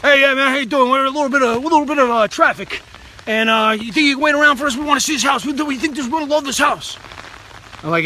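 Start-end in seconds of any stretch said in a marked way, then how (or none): 0.0-7.0 put-on Brooklyn accent. Brooklyn buyer asking the seller to wait, Brooklyn accent. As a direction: play the upbeat Brooklyn buyer, accent and all. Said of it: "he's got his Brooklyn".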